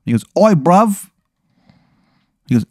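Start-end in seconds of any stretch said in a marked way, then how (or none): 0.4-1.1 high-pitched mock English punk; unconvincing English accent. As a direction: call out in a bad English accent. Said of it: "his accent isn't convincing at all".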